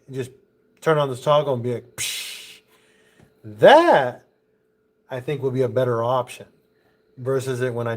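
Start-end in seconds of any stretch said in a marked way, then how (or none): 1.9-2.6 unvoiced hiss like air releasing; air hiss imitation. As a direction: blow out a short psh of air. Imitating a valve dumping air.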